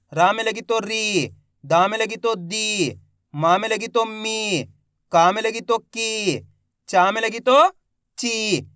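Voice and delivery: slow drill, holding "री", "दी", "मी", "की", "ची"; drawn-out long vowels. Shown as a demonstration. Teacher leading learners who repeat along, slow with long vowels. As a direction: stretch the ee in "री", "दी", "मी", "की", "ची". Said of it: "खींच के करेंगे इसका उच्चारण".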